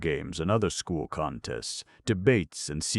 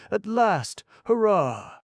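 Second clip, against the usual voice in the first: high, loud cheering; joyful cheer; 7 dB louder, slower than usual. A narrator's reading. Pinocchio cheering that he'll finally see father, high and loud. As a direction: shout it for sheer joy.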